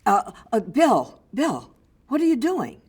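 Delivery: loud, high, incredulous call to Bill; mock outraged director stopping the scene; exasperated director. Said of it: "the director stops and he says".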